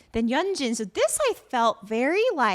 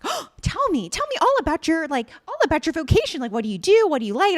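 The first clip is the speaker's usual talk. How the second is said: eager high flirt; 6 semitones higher, 5 dB louder, faster than usual. Retold or imitated. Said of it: "he's like".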